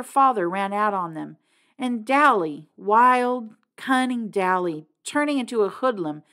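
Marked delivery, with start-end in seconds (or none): none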